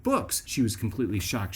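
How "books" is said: high, pleased single word; kid's proud answer. Retold or imitated. Boy answering his mom from the back seat, high and proud. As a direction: chirp it up high like a proud kid. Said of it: "It's like".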